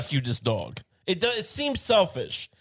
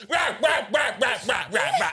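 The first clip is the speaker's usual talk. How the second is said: rapid, repeated raspy "rah" barks; mock dog barking; 5 semitones higher than usual. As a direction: imitate a dog barking over and over. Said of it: "just gonna bark every time".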